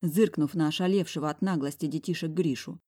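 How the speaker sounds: ordinary delivery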